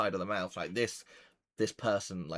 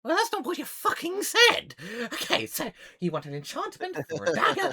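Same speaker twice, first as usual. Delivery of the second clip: high, loud gnome outburst; exasperated gnome; 8 dB louder than usual. Acted. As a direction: squawk at the customer, then regroup. Gnome snaps at customer.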